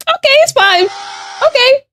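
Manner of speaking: very high, squeaky mock acceptance; mock squeaky okay. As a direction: squeak out a fake "it's fine". Brushing off a mean chat description.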